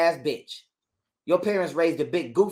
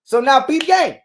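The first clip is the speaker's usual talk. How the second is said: much louder and higher, worked up; worked-up storyteller at full volume; heated; 7 semitones higher, 10 dB louder than usual. Real, not acted. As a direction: raise your voice in outrage. Heated rant about custody.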